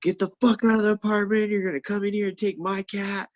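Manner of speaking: high-pitched mock screech; imitated screaming. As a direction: imitate an ex losing her mind. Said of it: "She's screaming at me".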